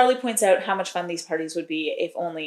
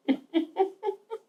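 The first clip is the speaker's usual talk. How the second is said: high, airy burst of laughter; real laugh; 14 semitones higher than usual. Real, not acted. Laughs at her own story.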